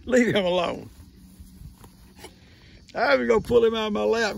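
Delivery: usual speaking voice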